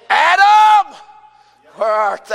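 very high, loud shout; calling out. Acted. God calling for Adam in the garden, calling out. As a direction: holler it across a garden.

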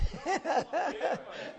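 high, rippling laugh. Real, not acted. Preacher after ranting at an unhelpful congregation, high laugh. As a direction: break into a high-pitched laugh. Laughs at his own rant.

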